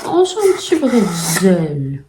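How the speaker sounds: slow, drawn-out delighted discovery; slowly savoured delight; delighted cooing. Real, not acted. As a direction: coo over a cute little find. Delighted at opening the day.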